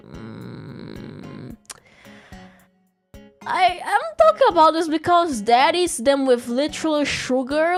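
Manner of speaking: usual speaking voice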